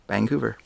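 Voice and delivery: Vancouver with a G sound, vang-couver; local Vancouver. Shown as a demonstration. Canadian showing the local way to say Vancouver. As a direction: slip a G between the N and the C in Vancouver. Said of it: "how they say Vancouver".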